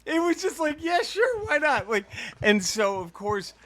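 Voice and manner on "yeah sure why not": high-pitched, tossed-off approval; breezy mock agreement. Mock promoter waving through a silly gimmick, breezy and high. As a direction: go along with it in a high voice.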